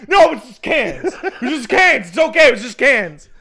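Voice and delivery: exaggerated, high-pitched relieved shouting; frantic relief. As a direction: call it out over the panic. Acted relief after the panic.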